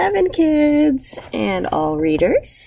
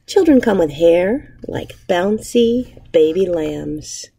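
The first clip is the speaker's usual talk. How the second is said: put-on reading to children. Teacher reading a picture book aloud to her class, gentle storytelling. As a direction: savour each image for young listeners.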